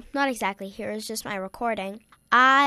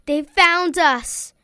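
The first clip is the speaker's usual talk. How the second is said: louder, higher, drawn-out alarm; mock dread; 6 semitones higher, 8 dB louder, slower than usual. Scared troll tells the others, dramatic alarm. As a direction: break slow, dramatic bad news as a troll. Frightened troll announces discovery.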